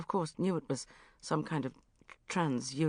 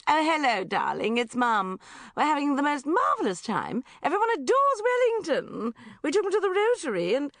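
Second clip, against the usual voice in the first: high, louder bubbly mum voice; gushing mother; 9 semitones higher, 5 dB louder than usual. Acted. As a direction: gush like an overexcited mother.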